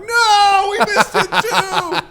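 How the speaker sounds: very high, slow wailing cartoon voice, laughing; whiny dinosaurs. Dinosaurs missing the Ark, high laughing wail. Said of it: "all these other dinosaurs are like".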